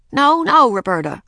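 put-on high, loud no-no to a toddler; child playing the strict sitter; scolding babysitter. A narrator's reading. Babysitter scolding baby sister.

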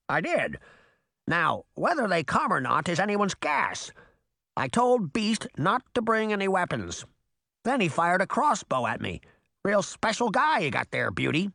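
high, wry bird character; squawking crow. A narrator's reading. Crow tells Beauty about Beast's crossbow, high squawk. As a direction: answer in the high crow voice, a little put out.

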